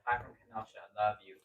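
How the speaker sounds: ordinary talk, nothing marked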